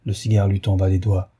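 ordinary delivery, nothing marked

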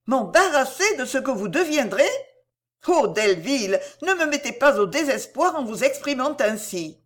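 a hint of a pleading young lady; distressed Cécile.

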